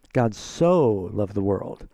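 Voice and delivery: "God so loved the world" stretched out, each word slow and weighted; slow and passionate. Shown as a demonstration. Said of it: "that, uh, phrase kind of denotes passion".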